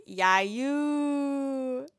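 light Hawaiian pidgin shout-out; pidgin slang. Retold or imitated. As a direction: call it out like a local friend hyping you up. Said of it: "everyone's like".

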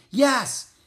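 high, loud answering call; shouting. A narrator's reading. Driver replying to Jack's hail, shouting. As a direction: shout back across the wind. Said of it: "waved and shouted".

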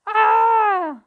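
high, loud lament; a long loud howl of woe; wailing cry. Acted. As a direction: wail in despair. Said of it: "se lamentó Maldeocus".

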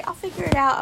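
loud, high parroting of others; mock quote. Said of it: "As soon as they say".

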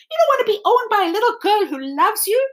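very high, fast gasping disbelief; be scandalised like a vain doll; shocked doll. Acted. The china doll shocked that Edward doesn't want buying, scandalised.

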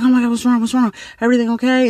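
high, anxious questions, re-enacted; mildly panicked checking in; alarmed concern. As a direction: act out a worried reply to a crying friend. Alarmed reply to crying friend.

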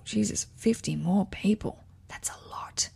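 breathy hushed whisper; shocked whisper under the breath; whispered aside. Real, not acted. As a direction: drop to a whisper for yourself. Whispered aside while reading.

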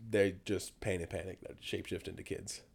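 ordinary talk, nothing marked

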